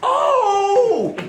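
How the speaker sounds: loud, high drawn-out "oh"; startled oh. Retold or imitated. Man facing a pistol, startled high "oh". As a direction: cry out in sudden mock realization. Reacts to pistol pulled on him.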